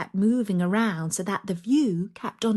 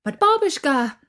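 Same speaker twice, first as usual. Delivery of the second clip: higher, louder terrified plea; frightened plea; 8 semitones higher, 7 dB louder than usual. Acted. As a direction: beg in panic for your life. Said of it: "Vasilisa was sure she meant to kill her".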